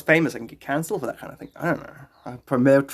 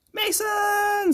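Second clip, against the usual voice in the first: high shouted greeting; excited shout. Real, not acted. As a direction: shout the name out with glee. Calling out a viewer's name.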